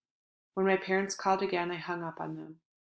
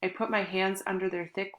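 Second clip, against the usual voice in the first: usual speaking voice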